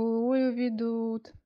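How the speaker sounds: slow, high, wailing complaint; drawn-out mock lament. Georgy teasing Anya on the phone, slow mock wail. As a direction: stretch the sigh out like a folk lament. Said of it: "неизвестно кому пожаловался Георгий".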